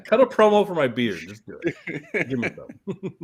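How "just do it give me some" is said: laughing. Real, not acted. Urging while laughing.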